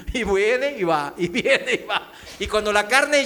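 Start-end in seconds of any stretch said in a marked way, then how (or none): 0.1-2.1 laughing. Shown as a demonstration.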